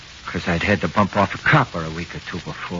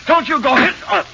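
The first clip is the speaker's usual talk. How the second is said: much higher, loud frightened protest; panicked yell; 6 dB louder than usual. Acted. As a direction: plead loudly as panic takes over. Suspect panics at the hose.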